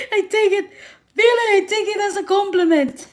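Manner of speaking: squealing with delight at a compliment; gushing, flattered. Real, not acted. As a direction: gush like you've been flattered.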